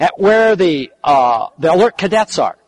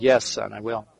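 gentle reassurance; 9 semitones lower, 9 dB quieter than usual. Retold or imitated. Tender reassurance to young man.

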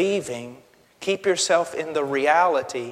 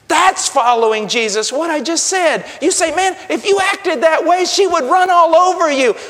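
high, loud preacher's rebuke; preacher shouting down a wrong idea of following Jesus; 10 semitones higher, 7 dB louder than usual. Real, not acted. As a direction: shout it at them, full force.

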